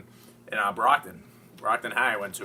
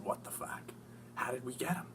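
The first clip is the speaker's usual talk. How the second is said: whispered disbelief; 10 dB quieter than usual. Retold or imitated. Retold reaction to phone news.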